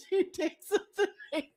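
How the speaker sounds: laughing so hard the words fall apart; helpless laughter. Real, not acted. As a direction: wheeze through it. Breaks down laughing.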